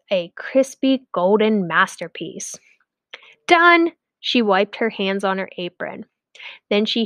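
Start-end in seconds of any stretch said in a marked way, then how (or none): 3.5-3.9 high, loud declaration; triumphant done. A narrator's reading. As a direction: announce it proudly.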